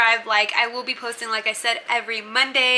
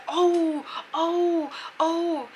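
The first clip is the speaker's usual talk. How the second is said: higher-pitched teasing "oh, oh, oh"; mock crowd oohing; 7 semitones higher, much slower than usual. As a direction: imitate a jeering circle of onlookers. Crowd hyping the fight.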